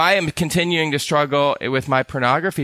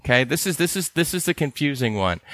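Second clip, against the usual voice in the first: ordinary delivery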